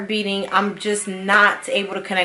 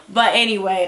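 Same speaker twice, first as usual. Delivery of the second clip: ordinary talk, nothing marked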